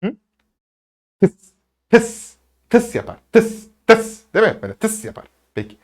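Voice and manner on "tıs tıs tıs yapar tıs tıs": hissing "tıs" like a snake. Shown as a demonstration. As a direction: imitate a snake with a short sharp hiss. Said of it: "Yılanlar ne yapar?".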